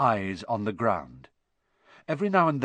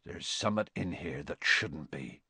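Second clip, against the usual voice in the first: low, hushed remark; uneasy murmur; 5 semitones lower, 6 dB quieter than usual. A narrator's reading. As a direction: keep it down, softly and low. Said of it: "he murmured".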